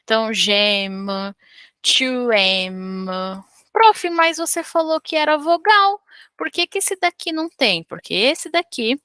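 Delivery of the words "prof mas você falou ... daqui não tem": high-pitched voice of a curious student; student asking. Acted. Teacher voicing a student who questions her. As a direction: pitch up and play a puzzled pupil.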